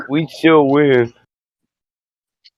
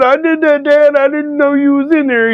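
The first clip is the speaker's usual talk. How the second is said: put-on sheepish teen self; 13 semitones higher than usual. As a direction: sound like a caught kid owning up. Teen confessing to dad.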